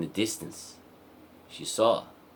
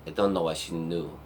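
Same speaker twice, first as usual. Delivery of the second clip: usual speaking voice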